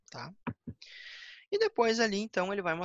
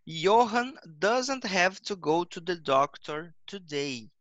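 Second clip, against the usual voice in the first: slow, loud, every English word laid out for the class; slow model reading; 2 semitones higher, 7 dB louder, much slower than usual. Shown as a demonstration. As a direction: read it out slowly and clearly like a teacher. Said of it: "A mesma coisa ali, ó". (leitura lenta e clara de professor)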